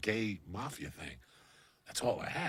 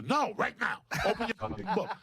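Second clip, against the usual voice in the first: much higher, louder retold outburst; insistent demand; 11 semitones higher, 6 dB louder than usual. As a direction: cut in hard with an acted order. Acted quarrel, cutting off excuse.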